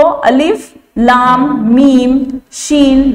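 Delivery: slow, spelled-out letter names; name each letter, drawn out for the class; spelling letters slowly. Shown as a demonstration. Teacher spells out the letters of the word, slow and deliberate. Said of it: "लाम को शीन में मिलाकर पढ़ते हैं".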